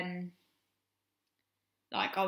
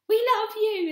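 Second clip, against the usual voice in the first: high, loud mock call; mock shout; 10 dB louder, slower than usual. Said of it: "he shouted back".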